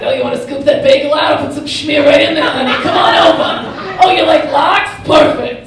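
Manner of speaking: loud, high New Jersey deli owner voice. Pretend.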